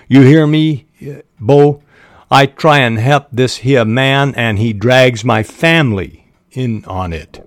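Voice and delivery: acted loud Southern complaint, settling as it goes. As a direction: raise your voice at first, in a Southern country accent. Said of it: "He raised his voice".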